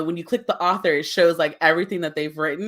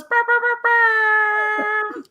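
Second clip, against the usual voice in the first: high buzzing horn noise; horn imitation; 4 dB louder, much slower than usual. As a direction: imitate a fanfare horn with lips. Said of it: "horn, the sound".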